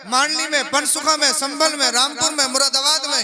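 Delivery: high, loud rhetorical oratory; full-force preaching. Acted. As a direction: proclaim it to the whole crowd, high and loud. (पूरे ज़ोर की बुलंद तक़रीर)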